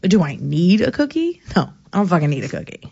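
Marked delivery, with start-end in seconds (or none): none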